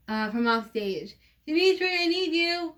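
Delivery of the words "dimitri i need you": calling out. Acted. Annie calls from off stage.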